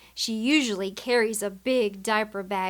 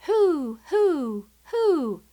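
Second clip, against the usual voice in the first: high, loud owl call; owl hoot; 6 semitones higher, 3 dB louder, much slower than usual. Retold or imitated. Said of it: "What does an owl say?".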